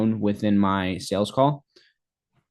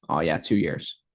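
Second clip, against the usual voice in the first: lower, offhand prospect voice; prospect's reply; 6 semitones lower than usual. Acted. Sales coach voicing a prospect's answer, lower and offhand. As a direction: play the client answering casually.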